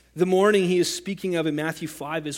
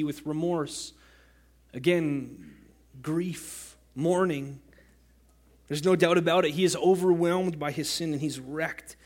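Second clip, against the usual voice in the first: normal talking voice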